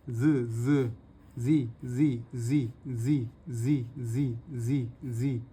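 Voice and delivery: voiced ज and जी repeated; buzzing z sound. Shown as a demonstration. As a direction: buzz the ज like a bee. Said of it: "जी बन गया".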